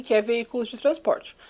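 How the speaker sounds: ordinary talk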